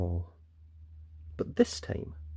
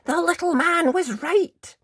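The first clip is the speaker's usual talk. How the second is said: much higher, louder thrilled realisation; boy thrilled to be proven right; excited thought; 5 dB louder than usual. Acted. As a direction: marvel with delight. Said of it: "thought Jack".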